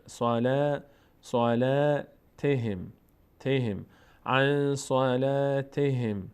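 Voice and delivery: slow recited صلاتهم with the aa drawn out; syllable-by-syllable chant of عن صلاتهم; long aa. Shown as a demonstration. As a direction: hold the nasal n of عن, then stretch صلاتهم.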